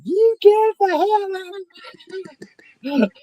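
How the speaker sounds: very high screechy woman's outburst; mock furious yell. Lady to the man pretending to be her husband, mock fury. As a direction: screech it as a woman throwing someone out. Acting out the lady ordering him out.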